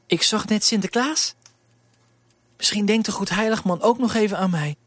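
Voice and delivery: put-on wishful little servant girl; girlish hope; young girl Elise.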